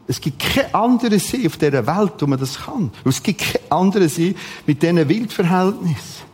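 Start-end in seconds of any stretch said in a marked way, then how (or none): none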